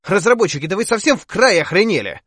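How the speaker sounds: high, loud furious yell at the developers. Acted. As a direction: bellow it into the room. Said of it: "Ещё раз проорал я".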